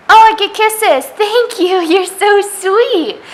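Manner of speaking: high, loud cooing with a laugh. Real, not acted. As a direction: coo at the bunny delighted. Baby talk to kissing bunny.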